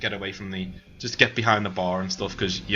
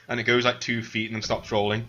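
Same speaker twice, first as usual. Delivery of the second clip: ordinary talk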